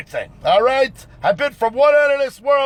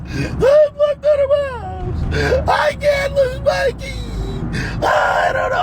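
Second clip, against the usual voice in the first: high, wailing damsel voice, hammed up; mock crying woman; 13 semitones higher, slower than usual. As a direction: play a panicked woman sobbing, locked out. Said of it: "we have women crying".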